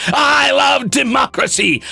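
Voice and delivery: high, drawn-out patriotic cry; rousing proclamation. Acted. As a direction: declare it like a saluting soldier. Salute-like rallying cry read aloud.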